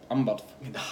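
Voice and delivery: soft, whispered dread; terrified whisper. Retold or imitated. Passenger scared of the driver, terrified whisper. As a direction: whisper it to yourself in mock horror.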